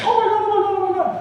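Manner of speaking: very high-pitched wailing panic; mock panic. News reporting a market crash, mock panicked wail. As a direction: imitate hysterical news in a high squeal.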